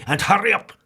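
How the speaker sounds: loud grumpy snap; snapped. Acted. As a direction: bark at the cake seller. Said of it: "snapped Mister Grumpy".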